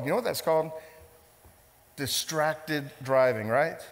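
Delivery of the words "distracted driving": Distracted driving stretched out slowly; slow emphatic. Real, not acted. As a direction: spell the term out slowly. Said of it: "what's that called".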